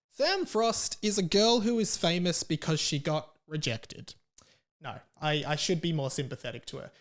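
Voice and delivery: usual speaking voice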